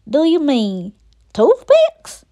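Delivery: slow, puzzled country question; puzzled country gator; country accent. Acted. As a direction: draw it out in a country twang, rising high at the end. Gator questions Lucy's odd word.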